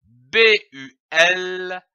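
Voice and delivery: ordinary delivery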